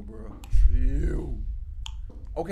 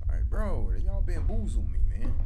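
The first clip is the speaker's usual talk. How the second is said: ordinary talk, nothing marked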